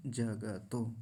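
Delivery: 'जगतो' slowly as ja-ga-to; three whole syllables in 'जगतो'; full ग. Shown as a demonstration. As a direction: sound the vowel after ग in 'जगतो'. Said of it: "अभी पूरा है".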